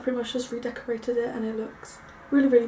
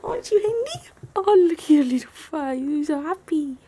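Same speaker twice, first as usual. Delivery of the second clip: dog talk; 6 semitones higher than usual. Real, not acted. Owner talking to the happy little dog, high-pitched dog talk. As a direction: coo high and fond at your pet. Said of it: "they do this really high-pitched".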